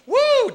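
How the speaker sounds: high, drawn-out "woo"; generic animal whoop. Shown as a demonstration. Comic showing everybody's cheer, whooping. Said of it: "it's just a generic animal noise".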